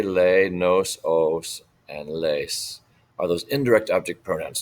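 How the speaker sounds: usual speaking voice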